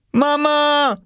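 high, drawn call for mother; cry for help. A narrator's reading. Oskar calling his mother from the locked room, crying out. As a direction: cry out like a desperate child. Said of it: "schrie er".

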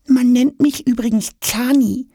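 high, confiding little-creature voice; trusting. A narrator's reading. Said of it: "erklärt der rosa Zahnspangenbehälter zutraulich". (zutraulich, piepsig)

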